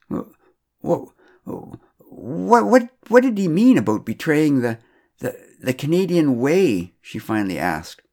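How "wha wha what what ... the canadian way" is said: much higher, stammering, close to tears; a choked, upset question; tearful stammer. A narrator's reading. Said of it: "smile had dissolved in tears".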